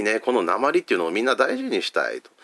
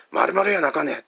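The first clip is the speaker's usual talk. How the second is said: higher-pitched Hakata comedian line; Hakata dialect; 8 semitones higher than usual. Retold or imitated. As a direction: toss it off like a Hakata manzai comedian. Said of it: "彼らがその方言で漫才をします". (博多弁の漫才風の台詞)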